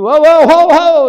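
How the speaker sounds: loud high alarmed whoa-ho; urgent calling out. Retold or imitated. Parent racing to a hurt child, loud high call.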